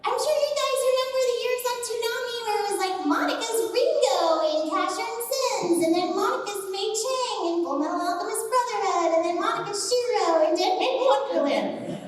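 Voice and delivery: put-on very high squeaky anime little girl. Shown as a demonstration. Voice actor listing her typecast roles, high squeaky voice. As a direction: hold a high squeak all the way through. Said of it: "do the Heinz squeaky voice".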